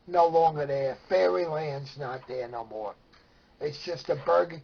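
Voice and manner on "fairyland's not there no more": ordinary talk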